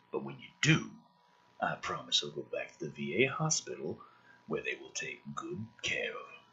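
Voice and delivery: a hushed, condescending villain; low, soft menacing villain. Acted. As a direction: talk down to the kid, quiet and cold. Traeger's lines voiced as villain.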